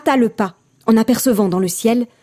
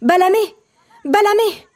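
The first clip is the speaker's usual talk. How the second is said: high shouted call; 10 semitones higher than usual. A narrator's reading. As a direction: call her name from afar. Child calling grandmother. (appel crié)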